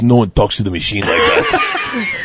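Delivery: put-on slow, low Russian gangster accent; a heavy Russian accent, dragged out slow; Igor's Russian voice. Igor defending his drinking buddy, slow Russian accent. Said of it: "Big Igor ... goes".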